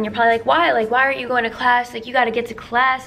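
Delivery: ordinary talk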